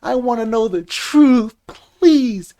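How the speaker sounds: imitating a woman begging for honesty; mock pleading woman. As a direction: beg for the truth in a mocking woman's voice. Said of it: "because women always say".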